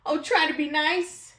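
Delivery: high pleading, still frightened; whimpering. Acted. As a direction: plead with the mouse, trembling. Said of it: "he whimpered".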